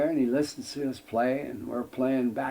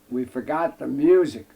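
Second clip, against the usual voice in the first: a mock yell, louder, tossed off to get past; retold yell; 7 dB louder than usual. Bass player brushing past the guard, mock yelling. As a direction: call it out like a hurried excuse. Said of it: "the bass player yells".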